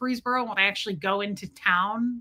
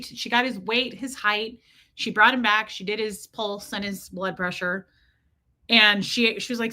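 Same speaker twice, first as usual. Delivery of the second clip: ordinary talk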